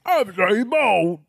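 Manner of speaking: high, slow fake Australian accent; mock Australian Bond. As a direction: squawk it in exaggerated Australian. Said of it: "That's an Australian Bond".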